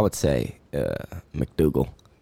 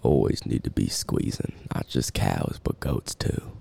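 lightly put-on cowboy drawl; 4 semitones lower than usual. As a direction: talk like a ranch hand. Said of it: "terrible cowboy voice".

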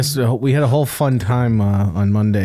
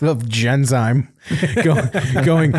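ordinary talk

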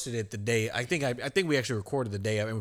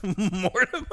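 laughing disbelief; 9 semitones higher, 4 dB louder, slower than usual. Real, not acted. Laughing incredulous repeat of name.

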